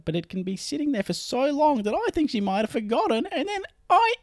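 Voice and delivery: high squeaky mouse voice. Acted. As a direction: read it like a tiny mouse. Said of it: "Barry's a mouse".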